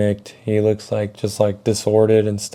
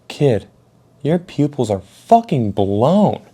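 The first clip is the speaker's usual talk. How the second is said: higher, drawn-out disbelief in disgust; 5 semitones higher, slower than usual. Retold or imitated. Angry mom looks me in my eyes, slow disgusted voice. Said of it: "pure disgust".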